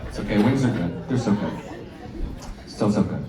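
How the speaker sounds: soft, low, savoring; quiet aside. Real, not acted. Comic to the crowd about the wings, soft and low. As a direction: murmur it to yourself lovingly.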